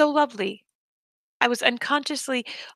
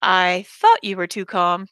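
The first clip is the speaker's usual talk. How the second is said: ordinary delivery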